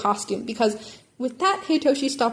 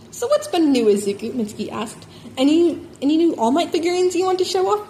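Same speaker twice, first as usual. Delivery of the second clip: brash auntie; 6 semitones higher, 4 dB louder than usual. A narrator's reading. Mitsuki voice.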